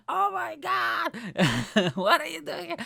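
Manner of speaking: loud, high imitated squeal with a laugh; hammed-up astonishment, voice way up; shocked surprise. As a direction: mimic a cousin shrieking at a surprise visit. Acts out cousin's shocked reaction.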